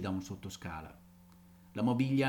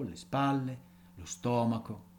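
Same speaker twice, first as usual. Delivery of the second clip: usual speaking voice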